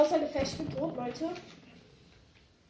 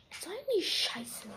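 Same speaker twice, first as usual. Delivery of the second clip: whispered angry curse; 4 semitones higher, 6 dB quieter than usual. Real, not acted. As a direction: hiss it without voice. Muttering at gunman.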